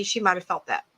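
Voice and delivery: normal talking voice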